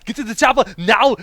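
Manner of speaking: put-on high, loud Austrian accent; hammed-up Austrian accent; Arnold Schwarzenegger. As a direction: yell it like an action hero. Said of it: "Give me your best Arnold Schwarzenegger".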